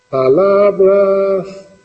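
very slow, stretched word left hanging; drawn-out prompt. Real, not acted. Teacher cues the class to complete the term, drawn out and suspended. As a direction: hold the vowels open for someone to finish.

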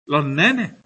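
Los nenes with the final s dropped, lo nene; aspirated s. Shown as a demonstration. Teacher showing Málaga s-aspiration to students. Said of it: "esa S no va a sonar nunca".

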